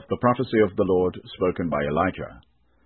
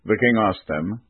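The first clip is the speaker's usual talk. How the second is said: usual speaking voice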